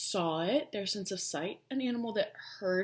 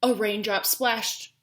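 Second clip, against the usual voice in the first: usual speaking voice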